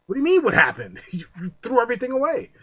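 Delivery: high, loud, fast incredulous rebuke; exasperated scolding. Pretend. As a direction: scold them in disbelief. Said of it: "It's like".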